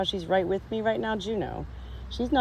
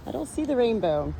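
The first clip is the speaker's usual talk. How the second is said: normal talking voice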